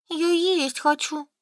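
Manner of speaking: high, drawn-out hungry whine; whining. A narrator's reading. Solka complains to her friends in the shop, whining. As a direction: whine it slowly, pitched right up. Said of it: "заныла Солька".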